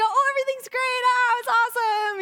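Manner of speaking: very high, bright, sing-song cheer; fake cheerful reply. Mocking how believers hide struggles, high fake cheer. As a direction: gush like nothing's wrong. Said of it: "So we go".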